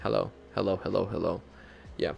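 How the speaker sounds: ordinary talk, nothing marked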